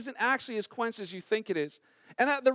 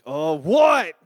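a touch of an exasperated dad; annoyed father; 6 semitones lower, 9 dB louder than usual. Retold or imitated. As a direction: snap back at the kid. Annoyed father answering kid.

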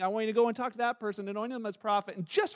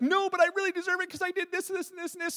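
put-on higher, pleading kid voice; pleading child; 9 semitones higher, faster than usual. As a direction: argue like a kid who deserves it. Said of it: "they've like".

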